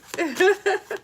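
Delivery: high burst of laughter; short laugh. Real, not acted. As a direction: break into a laugh. Laughing at swinger joke.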